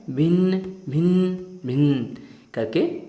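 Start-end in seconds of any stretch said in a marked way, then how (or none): 0.1-1.4 slow, high buzzing भिन भिन; fly buzz imitation. Shown as a demonstration. Teacher answers how the fly speaks, slow buzzing. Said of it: "मक्खी कैसे बोलती है?".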